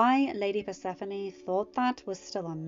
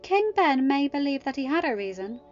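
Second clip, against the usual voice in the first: higher and louder, building to an outraged peak on Isle; indignant Leah; 6 semitones higher, 6 dB louder than usual. Acted. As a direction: work yourself up, ending in a loud burst. Leah's indignant self-defence.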